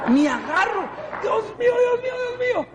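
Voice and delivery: crying to God in pain. Retold or imitated. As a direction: wail from sharp pain in the feet. Feet in pain after jumping.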